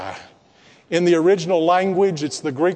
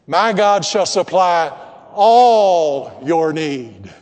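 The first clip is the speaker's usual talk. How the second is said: all your need drawn out slow and louder; the right word, held and loud; stress on ALL; 5 dB louder, slower than usual. Shown as a demonstration. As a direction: lean hard on all, slowly. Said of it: "why don't you say it with me?".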